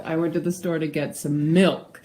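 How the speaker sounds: "milk" as the peak word, falling at the end; stress on MILK. Shown as a demonstration. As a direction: land the weight on "milk" and drop. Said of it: "how emphasis on certain words changes".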